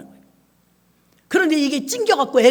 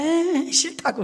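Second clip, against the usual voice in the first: put-on whiny child refusal; 6 semitones higher, much slower than usual. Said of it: "그럼 애가".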